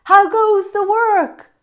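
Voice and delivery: lazy farmer calling out to the duck, high and loud. Acted. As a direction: call down lazily from bed. Farmer's repeated call.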